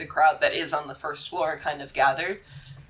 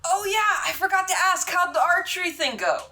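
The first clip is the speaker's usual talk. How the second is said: high cheerful character voice; bright and oblivious; NPC voice; 13 semitones higher than usual. Acted. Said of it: "turns to you guys and said".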